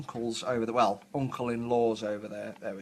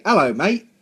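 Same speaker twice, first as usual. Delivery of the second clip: loud, higher-pitched mock British greeting; 6 semitones higher, 10 dB louder than usual. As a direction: put on a movie Brit accent. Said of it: "any Brits who say".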